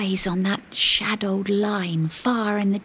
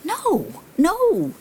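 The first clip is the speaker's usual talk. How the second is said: high-pitched acted refusal; raised character voice, objecting; objecting voice from above; 11 semitones higher than usual. A narrator's reading. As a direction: call down a protest in a high voice. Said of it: "the voice above says".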